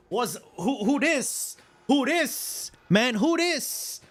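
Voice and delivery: amazed disbelief. Retold or imitated. Stunned by girl asking out.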